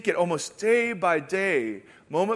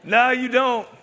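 laughing reply; 5 semitones higher, 3 dB louder than usual. Retold or imitated.

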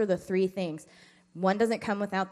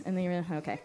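ordinary talk

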